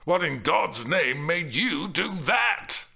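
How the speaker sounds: slow, loud, emphatic indignant demand; shouting back. A narrator's reading. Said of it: "he shouted back".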